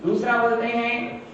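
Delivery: ordinary delivery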